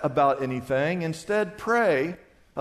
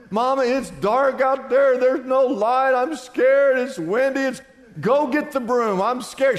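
higher, louder mock panic of a scared boy; panicky little boy; 6 semitones higher, 4 dB louder than usual. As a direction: whine it like a frightened child, tumbling out. Mother and scared boy voiced.

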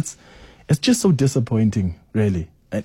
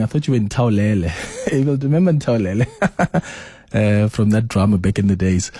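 ordinary talk, nothing marked

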